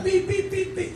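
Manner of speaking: high, soft beeping; little high-pitched horn toots; imitated car horn. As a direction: mimic a car honking in short, high beeps. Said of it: "el carro empieza a pitar".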